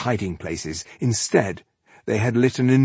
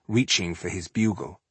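usual speaking voice

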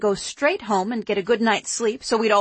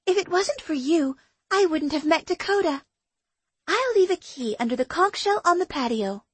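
higher, bright friend's voice; young woman voice; 7 semitones higher than usual. Acted. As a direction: voice her as an eager young woman. Shannon's dialogue, higher voice.

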